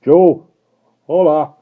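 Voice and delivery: loud hail across the coach; calling out. Acted. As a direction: shout up to the guard.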